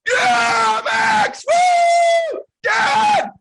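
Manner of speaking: screaming with joy, very high and loud; celebration scream. Retold or imitated. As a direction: yell it like you just won. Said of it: "We're screaming".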